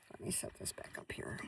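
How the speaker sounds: whispered aside to herself; hushed off-mic aside. Real, not acted. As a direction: drop to a whisper while fiddling. Quiet aside while adjusting camera.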